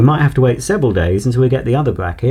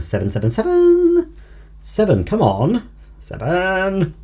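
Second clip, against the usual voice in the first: high playful sing-song calling; sing-song coaxing; 9 semitones higher than usual. Real, not acted. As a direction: coax it out like calling a pet. Coaxing the missing number seven.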